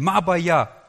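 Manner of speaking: loud, confrontational "Ma Baya"; shouted "what's your problem" in Hebrew; angry Hebrew retort. Retold or imitated. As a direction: snap "Ma Baya" back at a rude stranger. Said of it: "in Israel kann man doch das noch so gut sagen".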